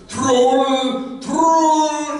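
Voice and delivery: high, loud drawn-out cry of warning; hammed-up alarm call; alarmed shout. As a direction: yell it out on the run. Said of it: "the first guy runs and goes".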